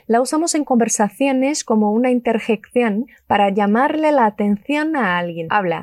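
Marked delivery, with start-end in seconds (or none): none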